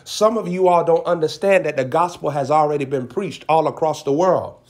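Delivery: ordinary talk, nothing marked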